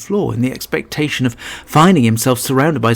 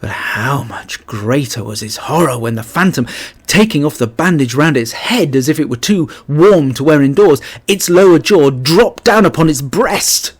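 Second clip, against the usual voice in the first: ordinary delivery, nothing marked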